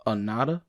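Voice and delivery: "Anata" said English-style as uh-NAH-duh; "Anata" with a heavy NAH and a soft tapped t; foreigner's mispronunciation. Shown as a demonstration. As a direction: stress the middle of "Anata" and weaken the outer vowels. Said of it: "The foreigner".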